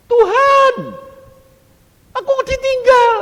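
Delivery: high, full-volume cry to God; shouting. Retold or imitated. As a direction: call out desperately across a great distance. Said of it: "Di situ saya teriak". (berteriak memanggil Tuhan)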